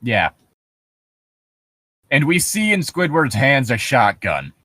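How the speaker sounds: ordinary delivery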